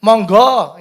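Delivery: loud demonstrated invitation; emphatic, projected urging; exclamation. Shown as a demonstration. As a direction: call it out with an exclamation mark. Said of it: "ini ada tanda serunya".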